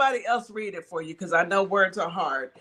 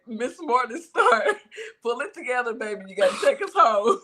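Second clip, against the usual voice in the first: laughing through words; 3 semitones higher than usual. Real, not acted. Laughing hard while talking.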